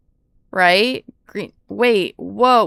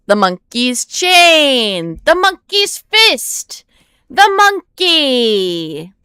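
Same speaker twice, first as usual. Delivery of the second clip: loud, slow, stretched excited cries; loud stretched-out monkey cries; excited drawn-out calls; 4 semitones higher, 6 dB louder, much slower than usual. Real, not acted. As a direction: call it out with real excitement. Excitedly reading the map.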